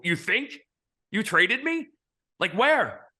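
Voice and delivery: can't believe what he's hearing; retold shock, pitch shooting up; incredulous. Reacting to being told he's traded.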